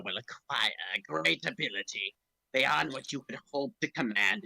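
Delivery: low, slow sinister emperor; Palpatine hologram. Acted. The Emperor's recording speaks to Vader, low and sinister.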